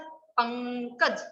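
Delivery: पंकज said very slowly, the ं nasal hum drawn out through the nose; slow teaching pronunciation of पंकज; nasal demo. Shown as a demonstration. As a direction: stretch पंकज to show the nasal. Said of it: "आ रही है नाक से आवाज".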